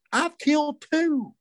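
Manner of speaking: slow, high, drawn-out gloating; smart aleck. Retold or imitated. Young hunter gloats to the old guy, slow high smart-aleck. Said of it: "I had to be kind of a smart aleck".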